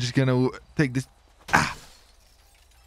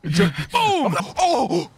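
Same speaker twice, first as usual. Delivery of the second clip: alarmed shout; 11 semitones higher, slower than usual. Real, not acted. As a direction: yell in protest as it happens. Friend attacks his car.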